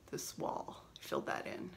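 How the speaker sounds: hushed whisper into the work. Real, not acted. Showing her stitching progress, hushed whisper. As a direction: whisper it close up.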